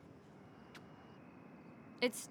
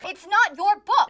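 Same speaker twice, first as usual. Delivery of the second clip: angry shout; 9 semitones higher, 13 dB louder, slower than usual. Acted. Argument with her father.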